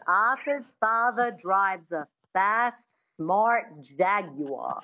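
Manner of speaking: measured transatlantic accent, Rs dropped. Shown as a demonstration. Coach repeating model sentence, transatlantic accent. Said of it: "use a sentence to get into accents".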